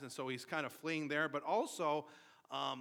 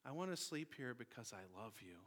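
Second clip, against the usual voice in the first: put-on softer, gentle child's plea; sweet soft voice; 4 semitones lower, 10 dB quieter than usual. Little son asks his father to sleep there, sweet soft voice. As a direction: coax daddy quietly and sweetly. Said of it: "with this really sweet, soft voice".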